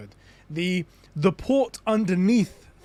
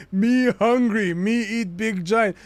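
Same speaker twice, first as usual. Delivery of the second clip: slow, louder, lilting put-on voice; slow giant-speak; 5 semitones higher, 5 dB louder, slower than usual. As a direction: play a slow, simple giant serpent. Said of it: "you need to say it in the".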